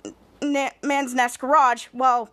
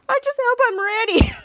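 high, laughing parody; mocking mimicry; 10 semitones higher, 4 dB louder than usual. Miles mimics Bose's hopeful line, laughing mockery. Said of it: "Miles begins to laugh".

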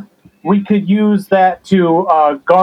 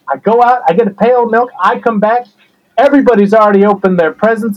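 ordinary talk

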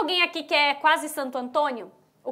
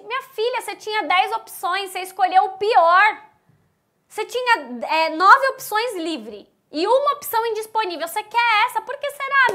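higher, louder teasing scold; 5 semitones higher, 5 dB louder than usual. Real, not acted. Teacher scolding a student's bad choice, higher and louder. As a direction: tease her like a fed-up big sister.

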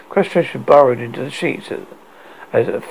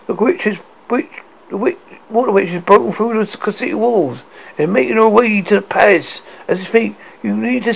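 breathless, rushed high-pitched report; panicked messenger blurting; frantic; 8 semitones higher than usual. A narrator's reading.

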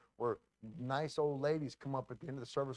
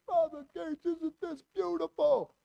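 high, slow South Philly Italian drawl; South Philly Italian impression; 3 dB louder than usual. South Philly Italian friend proudly showing off the buildings. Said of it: "that's how the Italian's talking".

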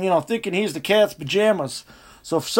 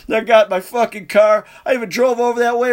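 usual speaking voice

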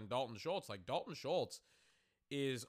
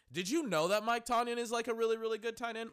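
hype voice; 10 semitones higher, 6 dB louder than usual. Acted. Mock promo pitch to viewers.

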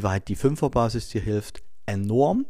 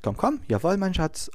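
high, sweet coaxing to a dog; coaxing dog talk; 7 semitones higher than usual. Shown as a demonstration. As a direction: lure the dog along, sing-song. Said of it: "nach vorn schaust und sagst". (lockend zum Hund, hoch und liebevoll)

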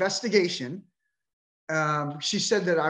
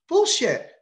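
bullshit flung out high and loud; furious outburst; 10 semitones higher, 5 dB louder than usual. Real, not acted. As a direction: explode at the excuse. Angry outburst at bar association.

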